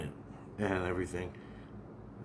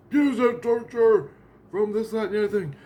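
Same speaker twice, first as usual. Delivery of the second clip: official voice; 8 semitones higher, 4 dB louder than usual. Retold or imitated. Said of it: "and he goes".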